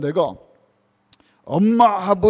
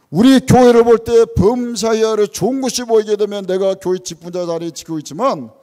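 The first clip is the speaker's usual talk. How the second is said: louder, rhetorical questions hammered out from the pulpit; preaching at full force; 2 semitones higher, 5 dB louder than usual. Acted. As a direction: press each question on the congregation. (힘있게 외치는 설교 목소리로)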